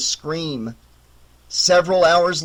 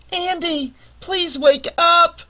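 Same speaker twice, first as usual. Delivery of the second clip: higher, slow woman's plea from afar; a woman calling him awake, high and slow; Jean's pleading voice; 10 semitones higher, slower than usual. A narrator's reading. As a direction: call him back, pleading. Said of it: "that sounds like Jean's voice".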